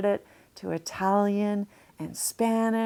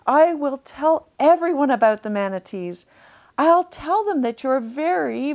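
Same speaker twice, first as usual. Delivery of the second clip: put-on louder, higher, eager little girl; eager promise; 5 semitones higher, 5 dB louder than usual. Janny vows to Chester to tell everyone, eager and loud. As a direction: promise it with all your heart.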